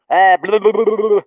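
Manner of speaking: loud, high gibberish burble; mock babble. Shown as a demonstration. Showing speech instead of telepathy, high mock babble. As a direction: burst into a high, babbling noise. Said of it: "instead of going".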